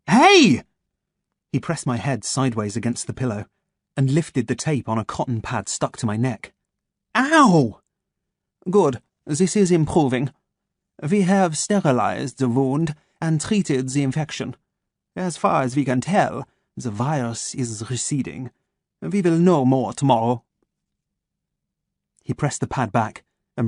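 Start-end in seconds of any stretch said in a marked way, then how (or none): 0.1-0.6 startled shout. Acted. Head pressed down by doctor. 7.1-7.8 pained yelp. Acted. Tape pulled off wound. 8.7-20.4 measured German doctor, each word placed; a calm German voice waking his captive; German accent. Acted. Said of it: "his German accent crisp and sharp".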